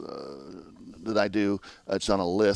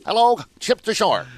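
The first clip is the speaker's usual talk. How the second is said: high, loud newsreel announcer voice; old radio announcer; 12 semitones higher, 8 dB louder than usual. Retold or imitated. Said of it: "Walter Winchell".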